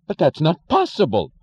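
loud, high cry of terror; terrified cry. Acted. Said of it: "The cry came from behind".

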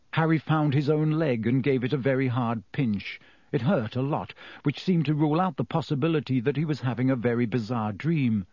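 usual speaking voice

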